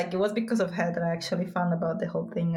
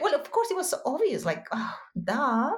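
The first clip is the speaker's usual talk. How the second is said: much higher, quick eye-rolling duh; mock self-scolding; 7 semitones higher than usual. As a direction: play yourself realising the obvious. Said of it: "and then I'm like".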